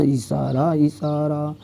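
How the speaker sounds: a teasing hint, fading out; hushed hint. Acted. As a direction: let it drop to a near-hush. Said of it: "teasing hint".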